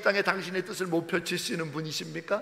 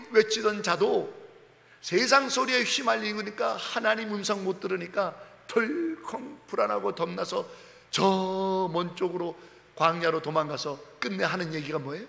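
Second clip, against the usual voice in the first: ordinary delivery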